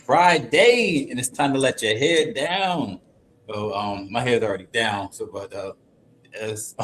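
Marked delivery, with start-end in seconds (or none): 0.0-3.0 drawn-out, swooping host greeting; hyped show intro. Acted. Host greets the YouTube audience on Friday, hyped and drawn out. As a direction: stretch the big words like a hype announcer.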